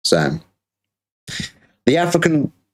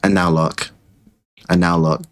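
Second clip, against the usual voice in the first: ordinary talk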